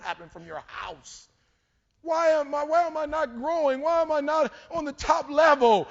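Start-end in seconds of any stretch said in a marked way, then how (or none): 2.0-5.9 high, loud, rising plea to the crowd; impassioned questioning. Acted. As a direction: cry out the questions loudly and high. Mock complaint, preached at crowd.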